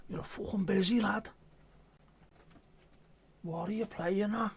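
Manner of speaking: high, broad put-on Scouse accent. Mocking chatter in a broad Scouse accent. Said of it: "going back to being an Uber Scouser".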